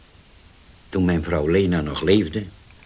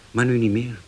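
ordinary talk, nothing marked

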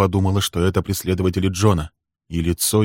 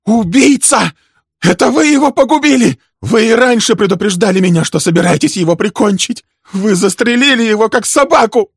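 high, loud hysterical accusation; furious woman screaming, pitch soaring; enraged screaming; 9 dB louder than usual. A narrator's reading. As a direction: shriek at him in full fury. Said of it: "это ещё больше взбесило женщину".

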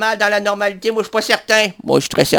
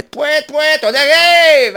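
silly horn honk; 4 semitones higher, slower than usual. Pretend. Honking like a bus horn.

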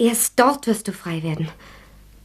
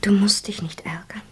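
soft soothing whisper; 3 dB quieter than usual. Acted. Sonja soothing.

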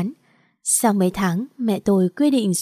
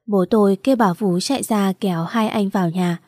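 ordinary delivery, nothing marked

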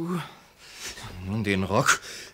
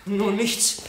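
much higher and louder, breathless haste; frantic, fluttering goodbye; flustered hurry; 4 dB louder than usual. Acted. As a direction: rush out the door, late and rattled. Hurrying off late in the morning.

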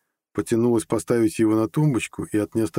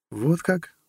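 high rising question; surprised; 9 semitones higher than usual. A narrator's reading. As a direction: react with mild surprise in a woman's higher voice. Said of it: "удивилась девушка". (удивлённо, высоким голосом)

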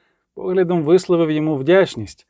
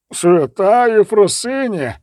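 slow, higher gasp of awe; crying out; 4 semitones higher, 3 dB louder, slower than usual. A narrator's reading. Abbot falling to his knees at a miracle, crying out. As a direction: exclaim it in pious shock. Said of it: "зойкнув абат".

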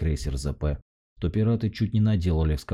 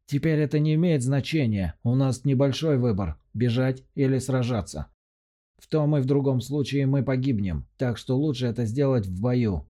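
high, pressing commander's voice; urging commander; 9 semitones higher than usual. A narrator's reading. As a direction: push the pilots to fight. Commander persuades pilots to fight.

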